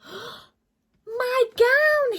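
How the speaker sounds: high, loud happy shout; joyful yell. Acted. George spots his old gown in the bag and yells with joy. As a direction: yell with delight at finding it. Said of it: "he yelled".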